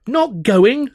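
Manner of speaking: high, loud disbelieving yell; frantic yell. A narrator's reading. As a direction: throw it back in frantic disbelief. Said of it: "I yelled frantic".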